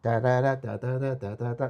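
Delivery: da da da sung as quick notes; tapping out the notes with your voice; sung arpeggio notes. Shown as a demonstration. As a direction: mimic the arpeggiator stepping through a chord. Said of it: "you're playing a chord of three note".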